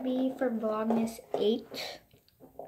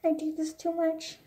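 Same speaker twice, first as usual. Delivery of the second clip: normal talking voice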